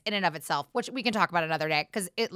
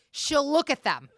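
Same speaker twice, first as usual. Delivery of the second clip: usual speaking voice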